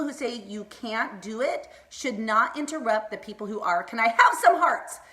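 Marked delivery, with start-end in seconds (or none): 3.8-5.0 louder, higher, excited plea; fired up. Real, not acted. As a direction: call out to the crowd, all fired up. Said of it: "I'm getting fired up already".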